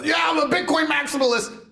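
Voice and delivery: high hammed-up crypto bro; mock crypto bro. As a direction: parody a proud maximalist. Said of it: "Putting up a Bitcoin symbol".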